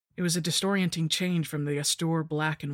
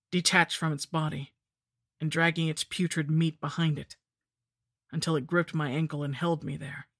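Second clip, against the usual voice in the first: ordinary delivery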